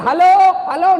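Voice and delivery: loud, high shout into the receiver; acted telephone yell; yelling hello. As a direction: holler hello down a dead line. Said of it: "Dia teriak".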